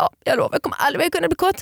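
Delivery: high, fast hammed-up self-pity; a whiny, overplayed sulk; dramatic sulk. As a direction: rush it out in mock despair. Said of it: "varför jag blev så konstig".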